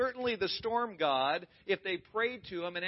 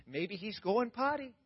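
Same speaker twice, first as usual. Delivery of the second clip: higher, jeering sing-song; mocking taunt; 4 semitones higher than usual. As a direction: tease them like a schoolyard bully. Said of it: "He's taunting them".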